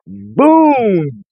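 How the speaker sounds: excited. Real, not acted. Gloating at troll's acknowledgment.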